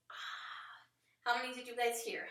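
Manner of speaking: ordinary delivery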